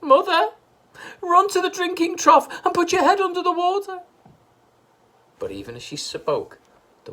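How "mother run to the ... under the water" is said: high, loud shriek. A narrator's reading. Missus Cranky shrieking at her mother, high and loud. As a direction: shriek the order in panic. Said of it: "shrieked Missus Cranky".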